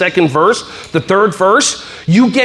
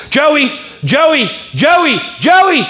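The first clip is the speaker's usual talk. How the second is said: high calls of the name, over and over; repeated calling; 6 semitones higher, much slower than usual. Retold or imitated. Teacher calling an ignoring kid again and again, insistent. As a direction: keep calling Joey, insistent.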